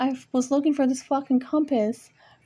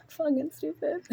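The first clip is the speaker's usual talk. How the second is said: higher, softer words through a laugh; real laughing; 5 semitones higher, 7 dB quieter than usual. Real, not acted. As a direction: let a small laugh squeeze the words high. Laughs at her own mistake.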